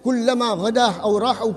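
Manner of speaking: ordinary talk, nothing marked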